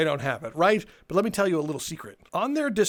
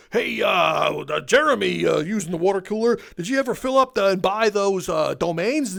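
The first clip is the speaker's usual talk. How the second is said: loud mock shout across the office; corporate boss calling out; 2 semitones higher, 4 dB louder than usual. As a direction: holler at the employee like a careless manager. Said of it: "someone in corporate's like".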